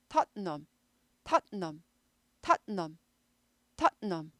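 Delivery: 탓넘 with a hard stop after 탓; 탓넘 drilled with a glottal stop then n; staccato Tottenham. Shown as a demonstration. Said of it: "스타카토 한 다음에 은 하는 거예요".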